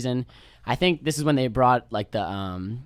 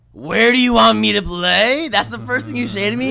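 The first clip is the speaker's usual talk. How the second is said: loud, high mock outburst; imitating a furious yell; 11 semitones higher, 9 dB louder than usual. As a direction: bellow it back at him in disbelief. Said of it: "He starts freaking out".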